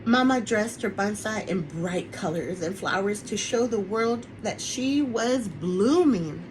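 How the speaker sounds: ordinary delivery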